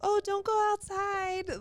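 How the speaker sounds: high, slow, loud fussing call; fussy adult. Retold or imitated. Bystander fussing at a stranger's child, high slow fussing call. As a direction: play the overprotective bystander. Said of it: "the type that's gonna be like".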